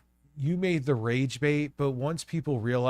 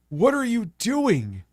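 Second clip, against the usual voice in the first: loud, high disbelief; exasperated shout; 10 semitones higher, 5 dB louder than usual. Real, not acted. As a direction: yell it in disbelief. Exasperated outburst.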